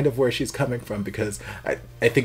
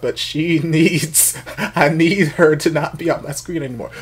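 excited laughing rant; 6 semitones higher, 8 dB louder than usual. Real, not acted. Laughing exasperated.

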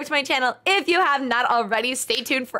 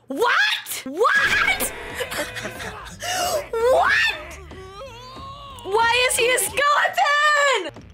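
shocked shout; 9 semitones higher, much slower than usual. Real, not acted. Seeing him turn into a monster, high shocked laughing shouts. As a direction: shriek your disbelief, half laughing.

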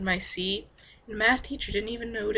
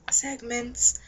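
ordinary talk, nothing marked

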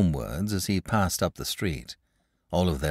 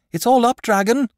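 high, loud urgent shout; 8 dB louder than usual. A narrator's reading. Boy shouting a warning to the dragon. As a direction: yell the alarm from afar. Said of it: "he shouted".

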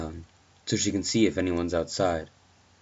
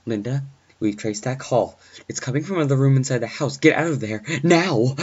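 fast, raised warning building to a shout; urgent; 8 semitones higher, 8 dB louder than usual. Acted. Dispatcher warning the babysitter to get out, urgent. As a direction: rush it out, louder and higher toward the end. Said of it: "His voice is urgent".

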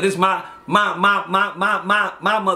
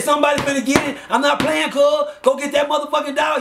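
angry order; 7 semitones higher than usual. Retold or imitated. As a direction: order the dog loose like a threat. Owner ordering dog attack, yelling.

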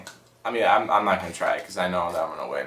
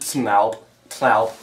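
backwards speech. Shown as a demonstration. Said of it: "we're gonna say words backwards".

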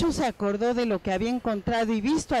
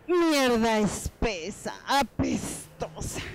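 ordinary talk, nothing marked

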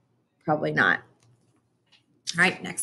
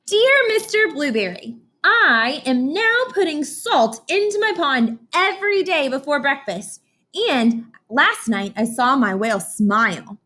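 put-on much higher, slower child voice; Emily's letter voice; 6 semitones higher than usual. Teacher voicing Emily telling her teacher about the pond, much higher. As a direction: sound like a proud little girl.

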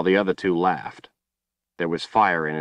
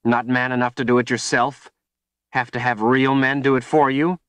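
taunting scream; 6 semitones higher, 3 dB louder than usual. A narrator's reading. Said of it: "she screamed".

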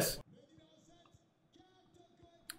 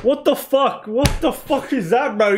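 high, incredulous outburst; can't believe what he just saw; shocked gamer reaction; disbelief; 8 semitones higher than usual. Real, not acted. Goal conceded, player blunder.

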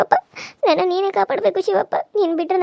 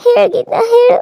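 high, loud pleading cry; desperate demand; 5 semitones higher, 5 dB louder than usual. Acted. Victim demands an answer.

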